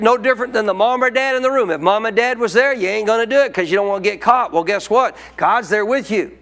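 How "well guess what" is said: ordinary delivery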